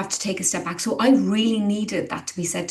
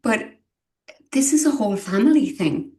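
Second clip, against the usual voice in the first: holding back tears as it slips out; a real wobble of feeling on the words; getting emotional; 4 semitones higher, 3 dB louder than usual. Real, not acted. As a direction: voice catching with real emotion, pitched up. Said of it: "sorry, I might get emotional about this".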